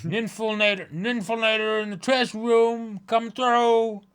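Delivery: ninfilnator instead of infiltrator, said high and proud; mangled word. Acted. Guard botching the word while raising alarm. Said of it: "You mean an infiltrator".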